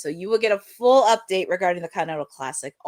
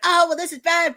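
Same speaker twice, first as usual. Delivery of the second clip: mock yell; 9 semitones higher, 4 dB louder than usual. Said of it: "I'm not gonna yell and be like".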